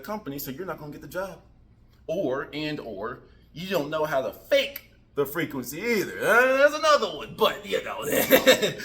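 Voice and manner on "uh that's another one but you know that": high, loud laughing aside. Real, not acted. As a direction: break into a laugh mid-thought.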